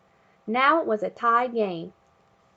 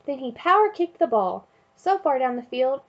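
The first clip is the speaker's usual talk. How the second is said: usual speaking voice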